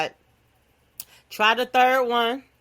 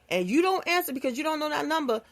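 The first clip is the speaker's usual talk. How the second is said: ordinary talk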